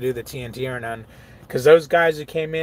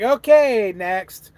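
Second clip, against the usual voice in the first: dismissive; 7 semitones higher, 6 dB louder, much slower than usual. Retold or imitated. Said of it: "it was like".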